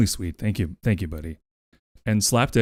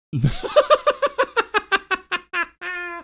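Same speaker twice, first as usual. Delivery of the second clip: laughing. Real, not acted. Streamer laughs at a donation.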